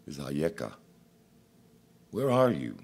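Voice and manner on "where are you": raised, calling voice of God. Acted. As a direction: call out searchingly.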